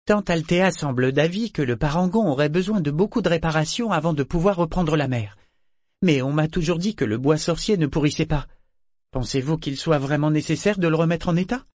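put-on high girl's questioning voice; young Malta, inquiring. A narrator's reading. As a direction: play the daughter probing politely, pitched well up.

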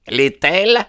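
very high, incredulous cry; high rising outcry; exclaimed. A narrator's reading. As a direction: shoot up in challenging disbelief. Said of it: "s'écria Baldwin".

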